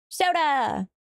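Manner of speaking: high, punchy shout of soda; excited call. Pretend. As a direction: yell it out like a meme. Shouting alert catchphrase.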